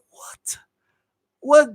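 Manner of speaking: high, disbelieving question; incredulous. Real, not acted. Host reacting to the cruel remark, incredulous. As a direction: blurt it out in disbelief.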